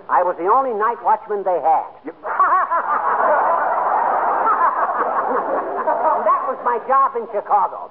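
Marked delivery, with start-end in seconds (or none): 2.2-6.1 a long, high, delighted belly laugh; hearty laugh. Real, not acted. Night watchman laughing at the police chief. Homer cracks up at his joke.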